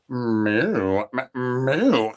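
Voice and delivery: a lightly voiced bull mooing; mock cow moo. Bull answers the call.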